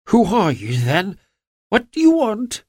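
frightened, pitched high and quavering; startled man, voice shaking with fear. A narrator's reading. Startled little man quavering at Holmes. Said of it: "in a quavering voice".

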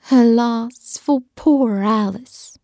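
ordinary delivery

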